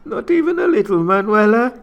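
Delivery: put-on flirty Spanish accent. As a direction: tease her playfully. Antonio pleads, high, loud.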